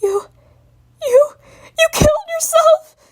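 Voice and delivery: high, loud breaking crying voice; bawling. Acted. Spike bawling out what he saw. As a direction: bawl it out as the worst confession. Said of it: "start bawling before he said".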